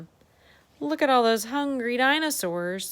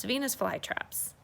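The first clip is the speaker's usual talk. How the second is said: usual speaking voice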